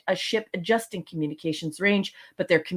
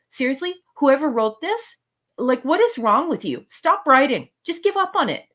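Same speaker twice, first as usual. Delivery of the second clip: exasperated rant; 6 semitones higher than usual. Real, not acted. Co-host rants at the episode's writer, exasperated.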